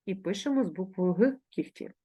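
ordinary talk, nothing marked

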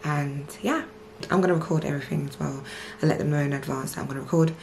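ordinary delivery

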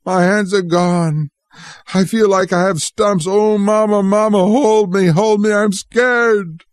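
cling to Mama in fear; scared pleading. A narrator's reading. Frightened boy begging his mother to hold him.

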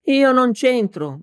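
slow, high-pitched plea, lightly faltering; faltering protest. A narrator's reading. Beaten man getting up, mildly faltering protest. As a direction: drag it out, pitched well up. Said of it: "balbettò l'uomo rialzandosi".

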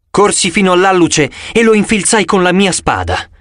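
plain reading voice

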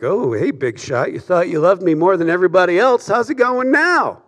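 sneering "big shot" jab, rising high and quick at the end; mocking taunt. Preacher imagining Jesus gloating at Peter, a sarcastic taunt. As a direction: rub it in his face like a smug rival. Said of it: "the force of which is probably all in the delivery and the intonation".

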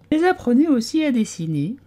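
a dozy little animal insisting it was awake; sleepy Dormouse. A narrator's reading. Dormouse defending himself to the tea party, drowsy and high. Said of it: "en bâillant et en se frottant les yeux".

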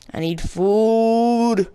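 high, long-stretched plea; drawn-out whine. Acted. Player desperate for food in the game, mock wail. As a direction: wail it, dragging out the last word.

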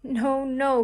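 loud, high no no; crying out. A narrator's reading. Sister grabbing her brother's arm, a loud cry. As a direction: stop him with a loud plea. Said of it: "cried Susie, grabbing his arm".

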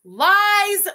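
loud high outraged shout; shouting lies. Real, not acted. Host shouting down a co-streamer's claim, loud outrage. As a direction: yell it as flat denial. Rejects claim McDonald's is halal.

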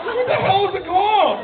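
high, incredulous comic outburst; retold bafflement. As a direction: ask in exaggerated disbelief. Retold baffled reply to fan.

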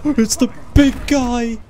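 high, loud, drawn-out wail; panicked cry. Real, not acted. Player spots the big guy coming, panicked high wail. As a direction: cry out in alarm, stretching the words.

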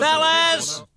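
loud, high shout for attention; shouting fellas. Acted. Calling louder over the noise.

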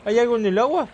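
high, loud alarmed repeat; panicked question. Real, not acted. Gamer panicking about something in the water. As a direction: ask it again in rising panic.

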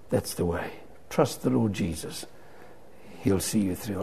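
low, soft, reassuring; gentle assurance. Retold or imitated. Evangelist quietly reassuring a seatmate about faith, gentle assurance. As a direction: quietly lead them to trust.

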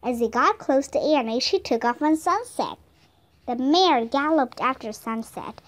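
ordinary delivery, nothing marked

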